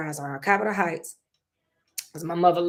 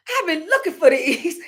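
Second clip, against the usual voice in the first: mom's reply; 9 semitones higher, 5 dB louder than usual. Retold or imitated. Mother answers about her teeth.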